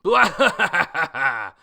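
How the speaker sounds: loud high jeering laugh; loud mocking group laugh; mocking henchmen laugh. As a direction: cackle like thugs mocking a boy. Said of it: "just start laughing at him".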